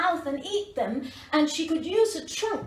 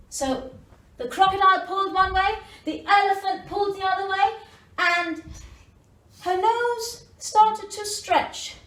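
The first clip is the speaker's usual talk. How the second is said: plain reading voice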